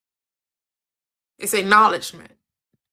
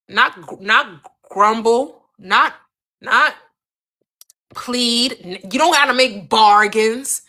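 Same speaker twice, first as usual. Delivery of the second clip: normal talking voice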